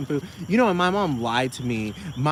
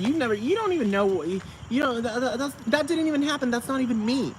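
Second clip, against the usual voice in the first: high-pitched defensive whine; high whiny protest; 10 semitones higher than usual. Retold or imitated. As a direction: whine it up high like you're caught.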